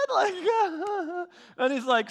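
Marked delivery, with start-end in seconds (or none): 0.1-1.2 real high laughter breaking in; laughing. Real, not acted. Pastor laughing at his own story, high. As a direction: lose it laughing at the memory.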